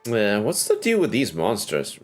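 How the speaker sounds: ordinary talk, nothing marked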